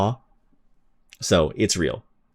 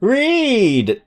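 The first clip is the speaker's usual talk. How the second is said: much higher shout of the name; excited call. Real, not acted. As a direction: call out his name with delight. Streamer greeting a resubscriber.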